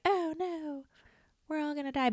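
high, soft whiny wail of doom; softly mocking the doomsayers; mock despair. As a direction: whimper it like a panicked kid. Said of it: "nobody wants to be like".

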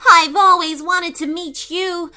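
high, gushing flattery; butter up the lion; flattering. Acted. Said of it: "with a flattering voice".

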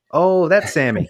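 a high, loud, scoffing mock of the excuse; mocking the tow company; sarcastic mock realization. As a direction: jeer it like suddenly it all makes sense.

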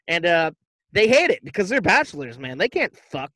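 ordinary talk

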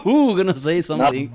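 laughing through the question; giggling while asking who will say it. Real, not acted. Friend asks through real laughter, laughing. Group cracking up over slip.

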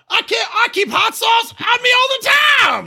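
shouted very high, hammed-up Southern twang; mock Southern accent. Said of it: "talking like a, you know, South".

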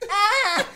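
loud fake moan; hammed-up moan, loud; mock moaning. Mom's moans in sex anecdote.

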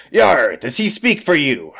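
salty pirate query; pirate diplomat. Acted. Pirate asks Sinead about Arthur, pirate voice. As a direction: open on a rolling yar. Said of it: "she turns to Sinead and says".

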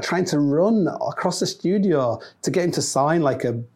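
ordinary delivery

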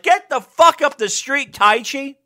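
heckling from the seats; mock fan yell. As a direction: yell it like a fed-up fan in the crowd. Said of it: "where you're like".

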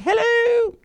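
squeaky high falsetto Irish cousin; really high voice. Acted. Friend's imitation of Irish cousin answering phone, really high voice. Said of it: "she had this really high voice".

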